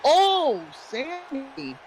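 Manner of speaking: high startled cry fading off; surprised shout. Real, not acted. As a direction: gasp out the name in shock. Commentator reacts to big move.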